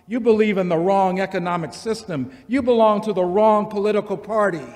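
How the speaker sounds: a preacher's litany that swells; building sermon litany. Acted.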